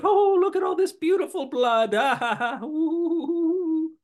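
high, far-off giddy cackling Joker voice. Retold or imitated. Joker gloats over spilled blood, giddy and cackling. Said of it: "he's giddy".

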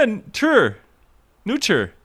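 high "Neuter" as NOO-cher, no first R; defended mispronunciation. Shown as a demonstration. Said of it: "neuter is a completely apt way".

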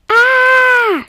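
long, high, loud scream; loud drawn-out shriek of terror; horrified scream. A narrator's reading. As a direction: scream out in sheer horror. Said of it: "schrie sie entsetzt".

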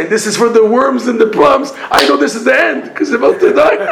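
high and loud, breaking into laughter; laughing punchline. Acted. Dying man explaining his wormy plums, laughing. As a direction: push the punchline out as laughter takes over.

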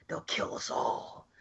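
whispered slow warning; slow spooky whisper; ominous whisper. Retold or imitated. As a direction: breathe it out slowly like a doomed prophecy. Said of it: "we do get the guy saying".

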